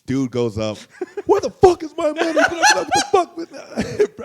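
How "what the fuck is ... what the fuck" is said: much higher and louder, drawn out with laughter; hammed-up furious yell; laughing imitation of a screaming man; mock yell. Guy yells for money again.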